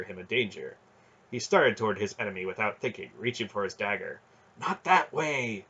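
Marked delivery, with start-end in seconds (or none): 4.6-5.6 higher, slow warning; dry inner voice, urgent. A narrator's reading. Voice in his mind checking the boy, urgent. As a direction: stop him with a drawn-out warning. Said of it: "It was the dry voice in his mind".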